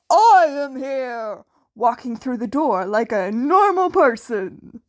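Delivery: booming hero entrance. Acted. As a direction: announce yourself like the number one hero. All Might's booming entrance.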